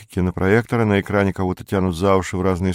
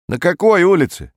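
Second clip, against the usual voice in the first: high, loud questioning; shop assistant; 10 semitones higher, 5 dB louder than usual. A narrator's reading. Shop assistant questions Perets about the street, high and loud. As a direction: query it sharply in a high voice. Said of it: "спросил продавец".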